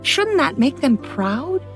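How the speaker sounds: usual speaking voice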